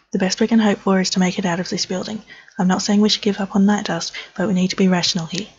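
normal talking voice

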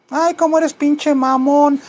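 high falsetto grumpy cat; talking cat. Pretend. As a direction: play an annoyed cat in a squeaky voice. Cat insults his owner. (gato fastidiado en falsete)

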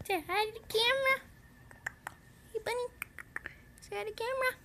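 very high cooing voice; pet coaxing. Real, not acted. Owner asking bunny to say hi, high cooing.

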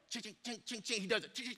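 very soft rapid chings; tiny mouth-made Morse taps; imitated Morse clicking. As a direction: mimic a telegraph under your breath. Imitates Morse code being sent.

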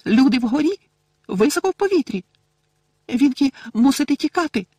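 very high, loud squeaky elf voice; frantic little elf warning them off; Winky squeak. Acted. Frightened house-elf Winky speaking.

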